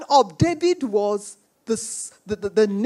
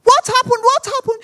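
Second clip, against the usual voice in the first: high, urgent repeated question; acted alarm on a phone call; 9 semitones higher, 5 dB louder than usual. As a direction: demand to know, voice raised. Retold alarmed reply on phone.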